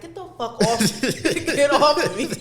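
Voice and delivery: put-on irritated at a drunk partner clinging on; mock annoyed push-off. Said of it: "It's like".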